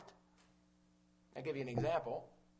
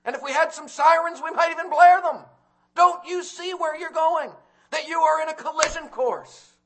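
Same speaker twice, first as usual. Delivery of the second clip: loud, high, urgent preaching; preaching at full force; 13 semitones higher, 16 dB louder than usual. Acted. Preacher warning the congregation, loud and high. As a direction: thunder the warning at the sinners.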